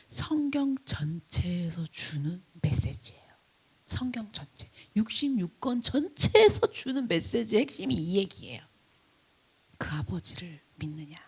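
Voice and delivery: soft, whispered emphasis; hushed sermon point. Acted. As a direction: lean in and whisper the core message. Hushed climax of the message. (핵심을 속삭이듯 조용히)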